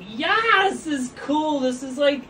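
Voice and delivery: light up with delight at the new toy; excited. Real, not acted. Host thrilled by the light gadget, excited.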